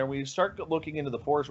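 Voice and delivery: usual speaking voice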